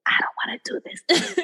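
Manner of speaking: whispered, half-laughing brush-off. Retold or imitated. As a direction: breathe it out like a secret shrug. Brushing off the rejection.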